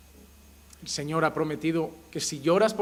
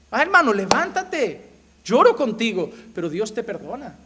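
consoling brother; 5 semitones higher, 6 dB louder than usual. Retold or imitated.

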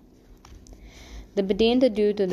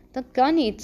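higher-pitched, moderately raised voice; mild narrated shout; 6 semitones higher, 4 dB louder than usual. A narrator's reading. Angelino protests at the accusation about the flies, mildly shouting. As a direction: cry out in protest, without full force. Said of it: "schreeuwde Angelino".

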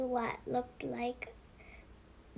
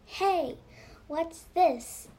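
eager, louder discovery; excited schoolboy discovery; 4 semitones higher, 5 dB louder than usual. A narrator's reading. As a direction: call out a sudden find.

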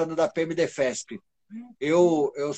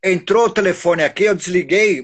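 shouting; 5 semitones higher, 5 dB louder than usual. Real, not acted. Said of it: "eu não preciso gritar então".

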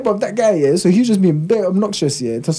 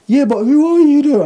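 higher whiny challenge; mock indignant; 11 semitones higher, 4 dB louder than usual. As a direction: whine it like an offended drunk. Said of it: "he's like".

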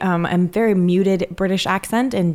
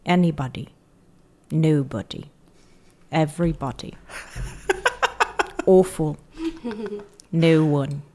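deadpan British pronunciation drill; British accent tape; 3 dB quieter than usual. Retold or imitated. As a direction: repeat words like a British accent CD. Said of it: "tape, like a C D on British accents".

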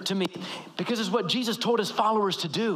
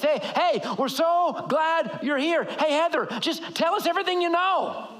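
high-pitched, gushing, over-eager welcome; eager host; 8 semitones higher than usual. Retold or imitated. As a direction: put someone on the spot with a bright fake cheer. Eager hosts pull you up front.

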